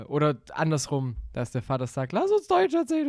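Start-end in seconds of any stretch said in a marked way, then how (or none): 2.1-3.1 high eager call; excited high voice. Retold or imitated. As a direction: cheer like an excited fan.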